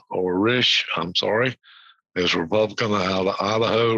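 usual speaking voice

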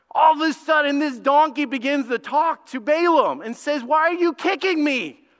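high, loud burst of astonishment; animated storytelling. Acted. Preacher telling how the donkey spoke to Balaam, animated. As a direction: act out the donkey's sudden protest.

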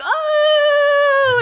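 high, loud delighted "Oh!"; excited gasp. Retold or imitated. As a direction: gasp with glee at the comeuppance. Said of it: "we were like".

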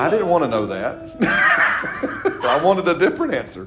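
chuckling through a mock complaint; amused mock letdown; laughing mock dismay. Acting a disappointed reply to God.

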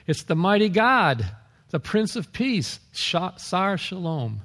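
high, ringing declaration; emphatic proclamation. Real, not acted. Preacher declaring to listeners who the government is, emphatic proclamation. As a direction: proclaim each title high and slowing at the end. Climax naming the Prince of Peace.

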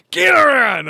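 high, loud shout while hauling a line; hammed-up straining holler; mock effort yell. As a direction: yell it out like heaving on a rope. Acting out urging himself on.

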